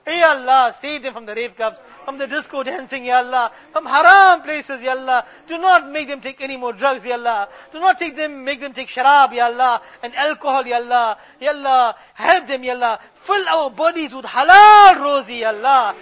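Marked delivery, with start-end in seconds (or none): none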